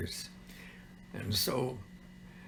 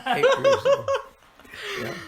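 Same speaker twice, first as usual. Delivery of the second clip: high, loud laughing words; 11 dB louder than usual. Real, not acted. Laughing at own age.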